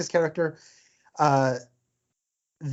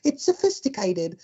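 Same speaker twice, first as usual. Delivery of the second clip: quoting the wife; 7 semitones higher than usual. Retold or imitated. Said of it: "She's like".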